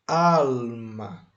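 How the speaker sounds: "Alma" with a long, strong "Al"; slow model of "Alma", stressed on "Al"; drawn-out stressed syllable. Shown as a demonstration. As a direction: stretch the first syllable of "Alma", then let "ma" fall. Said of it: "escute a pronúncia da palavra".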